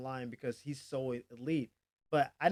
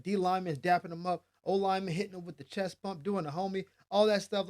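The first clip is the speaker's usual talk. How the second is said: ordinary delivery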